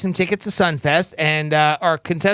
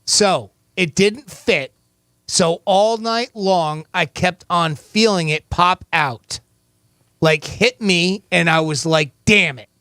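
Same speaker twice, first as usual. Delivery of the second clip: slow, deliberate quote reading; slower than usual. Shown as a demonstration. Host reads the quote slowly to the caller. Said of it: "here is your quote".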